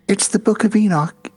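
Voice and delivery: lightly voiced solemn old woman; older woman. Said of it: "she starts saying".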